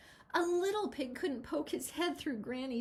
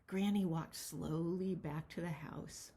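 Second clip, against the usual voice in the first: ordinary delivery, nothing marked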